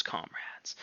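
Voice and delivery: sudden whisper; whispered comrades. Acted. Squealer confiding to the animals, whisper. Whispered aside inside speech.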